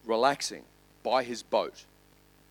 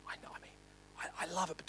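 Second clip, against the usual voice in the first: hushed confession under the breath; whispered aside; 4 semitones higher, 16 dB quieter, much faster than usual. Real, not acted. Pastor admits to the congregation he loves it, whispered. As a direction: let them in on a secret.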